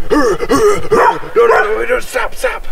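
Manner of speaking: high, urgent mock dog outburst; imitated charging dog. Owner retelling the dog's charge, frantic imitation. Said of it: "he came charging at me going".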